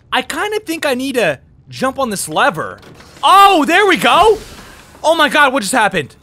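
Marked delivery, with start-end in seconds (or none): none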